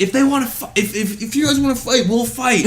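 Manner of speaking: high, sing-song mock challenge; mocking tough guy. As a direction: taunt them like a fake tough guy. Customer threatening fight.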